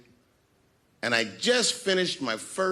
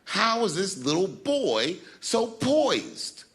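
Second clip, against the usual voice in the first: slow, higher-pitched mock amazement; awed older comics; 7 semitones higher, much slower than usual. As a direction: marvel slowly, imitating impressed old-timers. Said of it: "was in awe of me".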